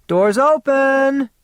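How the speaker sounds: high, drawn-out shout from another room. A narrator's reading. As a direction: call out loud and slow. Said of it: "she shouted".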